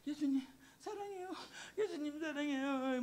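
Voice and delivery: weep the words of love to Jesus; crying prayer. Retold or imitated. Sick and alone, she weeps her love to Jesus. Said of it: "눈물이 너무 나서".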